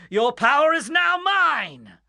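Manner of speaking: slow, high, loud triumphant claim; gloating villain shout. Acted. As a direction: crow it as you seize the power. Villain seizing power.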